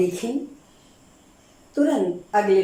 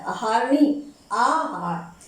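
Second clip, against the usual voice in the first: ordinary delivery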